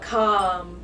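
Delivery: usual speaking voice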